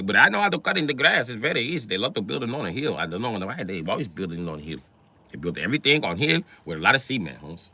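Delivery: mock Mexican accent, chatty landscaper; Mexican worker. Landscaper sizes up the ancient buildings, mock Mexican accent. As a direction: play a rambling workman with a Mexican accent. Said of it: "The S A was like".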